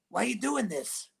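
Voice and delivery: mildly put-on voice of a distressed caller; a touch of mock pleading; imitating the caller. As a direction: lightly mimic how he talks on the phone. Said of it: "This is how he talks".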